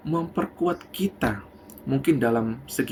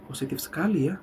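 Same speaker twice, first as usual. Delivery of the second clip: inner thought, softly; 2 semitones lower, 7 dB quieter than usual. Acted. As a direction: muse quietly to yourself. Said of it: "dalam hatinya".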